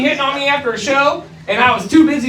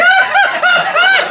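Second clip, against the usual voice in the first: high squealing shriek; hammed-up delighted shriek; mock woman's squeal. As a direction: imitate her excited squeal, much higher. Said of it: "she's like".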